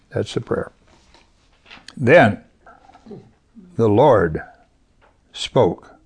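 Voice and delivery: ordinary talk, nothing marked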